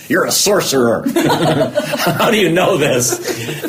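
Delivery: high mock-accusing astonishment, breaking into laughter; laughing retold disbelief; laughing amazement.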